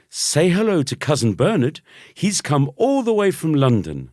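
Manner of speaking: mum's high, loud cheery voice; bright, pushy mother voice. A narrator's reading. Mum urging son to greet cousin, high and bright.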